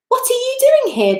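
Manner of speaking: high, sharp challenge from the wild ducks; demanding. A narrator's reading. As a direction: demand it like a hostile stranger. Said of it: "they demanded".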